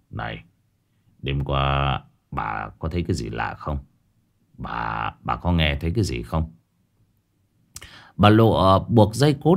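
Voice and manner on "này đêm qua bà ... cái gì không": much softer and lower, cautious asking; husband quietly probes wife about the night; hushed question. Acted. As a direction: ask quietly so as not to alarm her.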